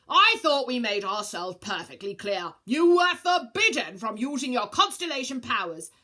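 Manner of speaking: loud, stern scolding; stern shout. Acted. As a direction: lay down the law loudly. Council scolds Virgo.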